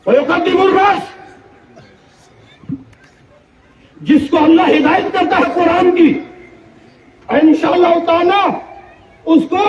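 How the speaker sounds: preaching at full force. Acted. Preacher to the gathering, preaching at full force. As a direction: drive the point home to the congregation.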